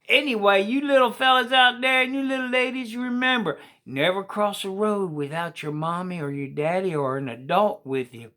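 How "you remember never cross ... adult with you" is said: usual speaking voice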